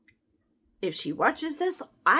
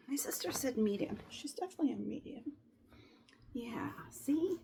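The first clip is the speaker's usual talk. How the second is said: whisper; 2 semitones lower, 10 dB quieter than usual. Real, not acted. Aside while sorting leggings.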